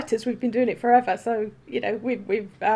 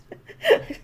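squealing laugh; 4 dB louder than usual. Real, not acted. Laughing at Firefly haters.

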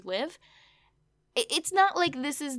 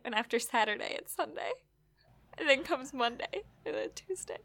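softer and softer, ending whispered; mock-patient fading list; teasing fade to whisper; 6 dB quieter than usual. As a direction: trail off slyly to a whisper.

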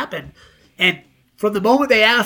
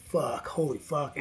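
much softer, slow murmured curse; quietly stunned at the praise; 4 semitones lower, 12 dB quieter, slower than usual. Retold or imitated. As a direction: say it under your breath, overwhelmed. Nervous reaction to praise.

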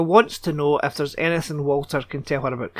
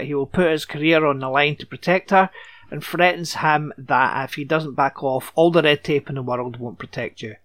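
ordinary talk, nothing marked

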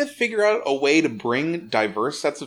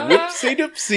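giggly whoopsie; 13 semitones higher than usual. Real, not acted. Laughing at own slip.

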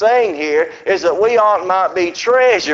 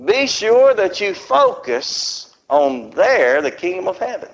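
a hint of a commanding God, drawn out slowly; lightly voiced divine command, slow; 3 semitones higher, slower than usual. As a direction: speak as God urging focus. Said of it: "for God to really say".

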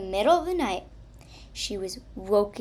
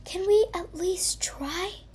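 pleading; 9 semitones higher than usual. Acted. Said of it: "pleaded Lice Comb".

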